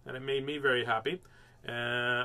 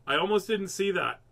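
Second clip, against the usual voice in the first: ordinary delivery, nothing marked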